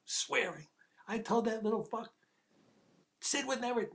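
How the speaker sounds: high-pitched furious swearing; swearing rant. Retold or imitated. Arthur Penn telling the writer off Pesci, high furious swearing. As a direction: spit out the insults, climbing high. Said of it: "He goes".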